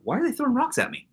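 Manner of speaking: high, fast, bewildered little voice; puzzled child. Retold or imitated. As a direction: squeak it out like a confused kid. Child's puzzled question retold.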